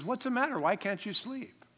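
a wife woken in the night, gently puzzled; wife asking. Acted. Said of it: "She says".

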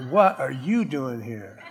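shocked heaven folk laughing; laughing disbelief. Acted. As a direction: chuckle out the question. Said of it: "They're gonna go".

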